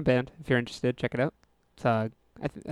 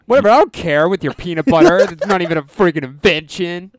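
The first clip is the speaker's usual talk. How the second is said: mocking imitation; 4 semitones higher, 10 dB louder than usual. Mocks co-host's dismissive reply.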